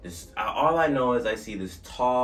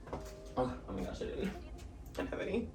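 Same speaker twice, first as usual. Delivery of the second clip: whispered aside; 10 semitones higher, 11 dB quieter than usual. Real, not acted. Aside about missing chapstick.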